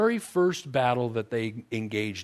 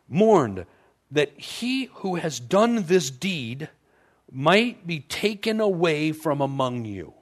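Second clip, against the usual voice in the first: scripture reading; 5 semitones higher, 4 dB louder, slower than usual. Acted. Reads scripture aloud.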